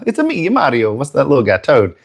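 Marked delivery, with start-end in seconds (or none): 0.1-0.9 Mario impression. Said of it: "I could be".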